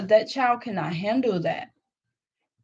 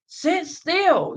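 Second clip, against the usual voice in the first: much higher, louder barked command; harsh parent; 9 semitones higher, 5 dB louder than usual. Retold or imitated. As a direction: yell it while gripping the child. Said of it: "put their child in a chokehold".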